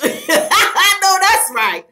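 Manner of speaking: loud, high, slow words through laughter; cracking up while agreeing; laughing. Real, not acted. Laughing after the catchphrase.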